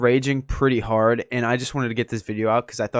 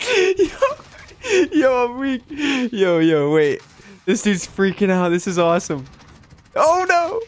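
high, loud breathless giggling; weak with laughter; excited squeals while the opponent freaks out; laughing hard; 12 semitones higher, 4 dB louder than usual. Real, not acted. Cracking up during match.

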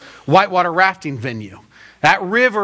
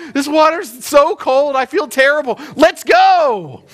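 high-pitched comic grumbling into a louder call; mock misery; 8 semitones higher, 4 dB louder than usual. Rafter carrying the boat into cold water, mock miserable complaint. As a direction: complain higher, then push out the last words.

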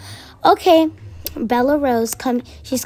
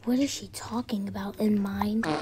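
soft whispered gossip; 5 semitones lower, 12 dB quieter than usual. Acted. Classmates gossip about girl, whispering. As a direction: whisper behind her back.

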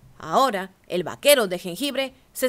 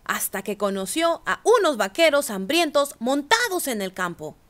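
plain reading voice

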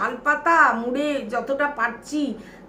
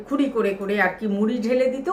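ordinary talk, nothing marked

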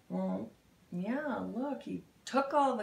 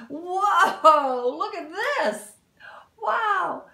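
high, loud amazed exclamation; loud, high thrilled whoa; excited wow; 8 semitones higher, 10 dB louder, much slower than usual. Real, not acted. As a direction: gasp in delight at the page. Reacting excitedly to picture.

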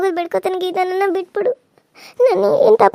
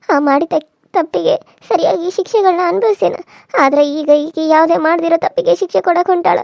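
ordinary talk

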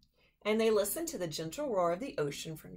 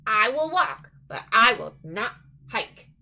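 grumbling; 3 semitones higher, 10 dB louder than usual. Acted. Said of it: "he grumbled".

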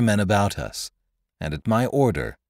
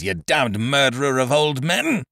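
furious yell; 6 semitones higher than usual. Acted. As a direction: hurl it as a curse. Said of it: "he yelled out".

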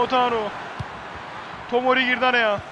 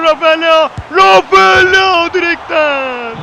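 loud, slowed shout off the post; excited commentator shout; 3 semitones higher, 6 dB louder, slower than usual. Real, not acted. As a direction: yell the near miss, dragging the name. Near-miss off the post.

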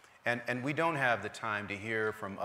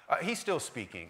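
ordinary delivery

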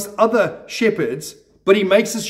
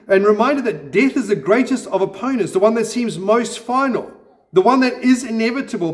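normal talking voice

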